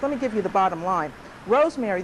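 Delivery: normal talking voice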